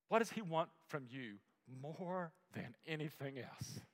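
ordinary talk, nothing marked

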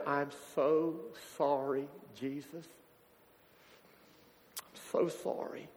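weeping apology. Retold or imitated. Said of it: "He began to weep".

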